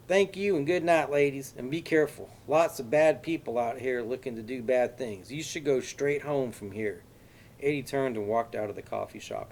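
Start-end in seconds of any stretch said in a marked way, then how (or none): 0.1-7.0 put-on high, loud Texas accent, folksy with a menacing edge; overdone Texas accent, louder and higher; exaggerated Texas accent. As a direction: play the friendly Texan with a threat underneath. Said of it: "said in an exaggerated Texas accent".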